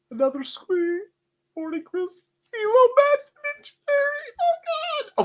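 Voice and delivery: very high, strained squeaky cries; squealing. Real, not acted. Reading out chat names with excited high squeals. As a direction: squeal like a delighted fan.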